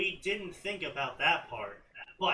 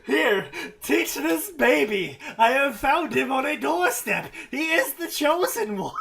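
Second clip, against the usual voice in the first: put-on high, loud, laughing old wizard; confused old man; 9 semitones higher, 10 dB louder than usual. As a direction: play a baffled old man barely holding in laughter. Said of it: "Extremely confused old man".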